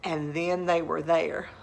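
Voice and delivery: usual speaking voice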